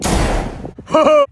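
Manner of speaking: shrill character cackle; high cackling laugh. Acted.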